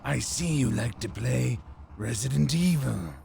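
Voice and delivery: lightly voiced psychic villain; a hint of a creepy mind reader; Psycho Mantis voice. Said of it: "I didn't do that in the Psycho Mantis voice".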